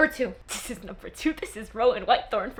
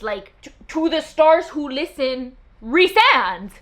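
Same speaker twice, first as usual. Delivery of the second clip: slow, louder, stars stretched out; emphatic recital; 3 semitones higher, 7 dB louder, slower than usual. Shown as a demonstration. Repeating the favourite line to viewers, slow and grand. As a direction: say to the stars who listen slowly and grandly. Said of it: "emphatic repeat".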